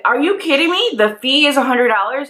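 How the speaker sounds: high incredulous outburst; can't believe what she's being charged; retold outrage at the parking attendant; outraged disbelief. Outraged at the fee.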